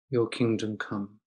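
ordinary delivery

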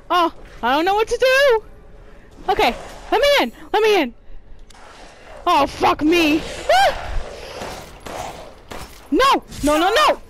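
high, loud and fast; frantic panic. Real, not acted. Gamer fleeing the monster, frantic and scared. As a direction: gabble in terror.

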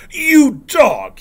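loud, high roar of anger; furious bellow, pitched high. A narrator's reading. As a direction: roar the insult out. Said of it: "he roars".

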